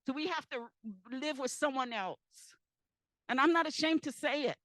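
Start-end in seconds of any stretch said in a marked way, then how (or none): 3.3-4.6 louder, insistent, words pressed out one by one; real loud plea. Real, not acted. Telling the panel her people need help, loud and insistent. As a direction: demand help from the panel, not backing down.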